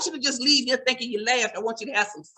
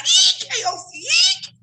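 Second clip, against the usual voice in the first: very high shrieked EKOC; screaming out; 5 dB louder than usual. Retold or imitated. Parched churchgoer screaming to the usher, high shriek. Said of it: "So they screaming out".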